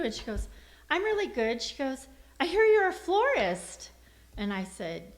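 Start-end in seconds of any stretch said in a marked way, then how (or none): 0.9-1.6 higher-pitched imitation of a cheery caller; friendly caller. Voicing the caller's friendly reply. 2.4-3.9 high, louder imitation of a caller; eager caller. Voicing the caller's eager line.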